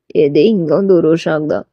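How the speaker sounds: low man's echoing question; low-pitched, questioning male character; voiced male lead. Taeyang echoes her in low voice.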